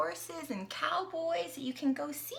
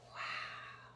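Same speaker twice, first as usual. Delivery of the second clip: plain reading voice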